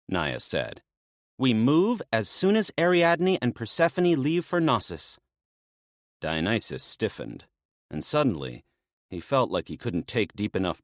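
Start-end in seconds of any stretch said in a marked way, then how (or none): 1.4-5.2 lightly voiced woman, brisk and businesslike; a hint of a maenad laying out the plan; briefing maenad. Naya briefing Dionysus on the rescue plan, lightly voiced.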